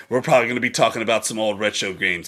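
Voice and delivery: normal talking voice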